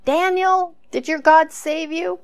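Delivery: calling out high and loud into the dark pit; crying out. Retold or imitated. King calling down into the lions' den, high anxious cry. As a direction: shout down the hole, desperate to hear back. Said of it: "he cries out".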